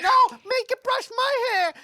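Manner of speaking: put-on high, loud whining kid voice; whiny little sister. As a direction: demand it shrilly like a spoiled sister. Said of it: "And she's like".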